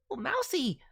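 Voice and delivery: ordinary talk, nothing marked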